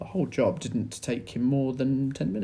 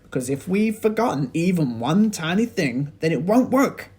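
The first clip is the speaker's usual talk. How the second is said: high, loud, hurried pleading; urgent Mister Cranky; 8 semitones higher, 11 dB louder than usual. A narrator's reading. Mister Cranky urges George to tell him quickly. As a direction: press him to tell you now. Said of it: "cried Mister Cranky".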